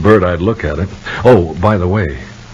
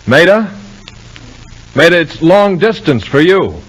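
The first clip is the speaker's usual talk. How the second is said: raised voice, calling her to the phone; 11 semitones higher, 4 dB louder than usual. Acted. Husband calls Maida to the phone, loud. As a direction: answer the phone loudly, then call across the house.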